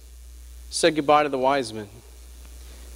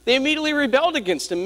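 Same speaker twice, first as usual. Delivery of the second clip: raised, fervent preacher's delivery; preaching; 9 semitones higher than usual. Acted. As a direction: preach it higher, building to the congregation.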